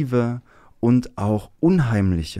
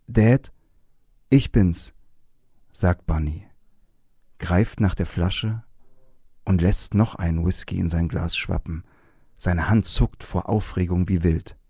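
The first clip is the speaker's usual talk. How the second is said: story reading; 3 semitones lower than usual. Acted. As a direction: read it aloud with weight and pauses. Reads a novel passage aloud.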